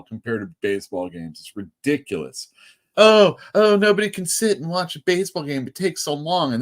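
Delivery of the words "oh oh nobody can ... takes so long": high-pitched mock moaning, loud at the start; mocking whiny complaint. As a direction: imitate a whining complainer, pitched way up. Mimics complainers about long baseball games.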